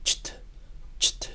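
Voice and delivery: "Cht" as a soft ch straight into t, no vowel; just the two consonants, quietly; bare ch-t cluster. Shown as a demonstration. Teacher demonstrating the cluster to students, soft. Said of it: "Imaginen diciendo che y te sin vocales".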